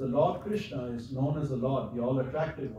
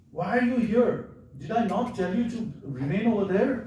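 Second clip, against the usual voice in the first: raised, higher-pitched mock reprimand; stern scolding; 7 semitones higher, 5 dB louder than usual. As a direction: retell a brother's rebuke, loud and high. Voicing Rama scolding Lakshman.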